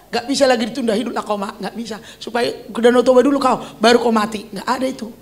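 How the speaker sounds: usual speaking voice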